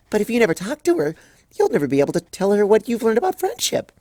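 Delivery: high, quick earnest objection; bubbly Pinkie. Acted. Pinkie objecting to Twilight's plan, high and quick. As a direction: rattle it off eagerly.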